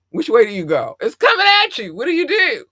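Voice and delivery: rising panicked questions, high and loud; squeaky mock terror. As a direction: play the helpless panic of a meteor coming at you. Panicked questions about a meteor.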